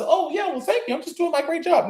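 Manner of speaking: high, put-on modest voice; mock bashful high voice; mock flattered voice. Mocking someone taking compliments.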